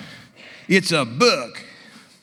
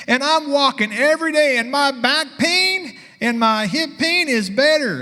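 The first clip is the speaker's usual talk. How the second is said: higher, lightly voiced woman; happy patient; 9 semitones higher than usual. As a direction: tell it proudly in her voice. Patient reports her changes in story.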